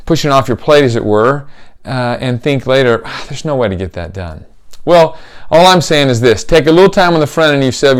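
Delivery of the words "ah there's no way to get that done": soft, low sigh of defeat to yourself; quiet defeated aside to yourself; muttered inner dismay. Retold or imitated. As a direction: say it under your breath like you've given up. Dismayed inner thought, said later.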